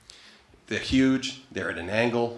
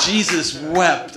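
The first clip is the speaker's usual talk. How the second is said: slow, loud, laughing through it; 7 semitones higher, 8 dB louder, much slower than usual. Real, not acted. Comic cracking up at his own story, laughing. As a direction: crack up on each word. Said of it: "laughing at the memory".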